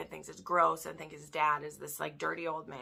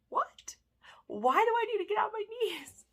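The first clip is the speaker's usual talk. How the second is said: much higher, shocked disbelief; baffled squeak; 3 dB louder than usual. Retold or imitated. Heroine told to kneel, answers in high shocked disbelief. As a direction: squeak it out, totally thrown.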